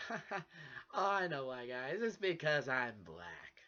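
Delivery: loud mock laughter, then a slow pointed punchline; friend's father just sent him off, sarcastic laughing answer. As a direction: laugh it off sarcastically, land the last line slowly. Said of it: "sarcastically laughing say".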